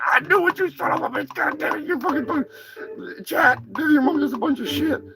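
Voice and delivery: high, squawky put-on turkey voice, annoyed; angry turkey character. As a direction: play an irritated turkey scolding his pal.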